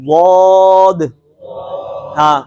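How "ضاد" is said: واد drawn out very slowly, tongue sides on the upper molars; Arabic dad as a model. Shown as a demonstration. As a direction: stretch out the ضاد as واد, slow and full. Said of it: "تو یہ اس کا مخرج ہے واد".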